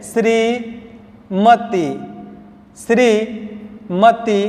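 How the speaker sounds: श्रीमती drawn out, short ti; slow dictation. Shown as a demonstration. Teacher dictating श्रीमती, slowly. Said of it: "यहाँ भी फिर गलतियाँ मिलती है".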